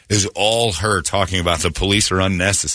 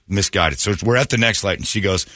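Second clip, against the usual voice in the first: usual speaking voice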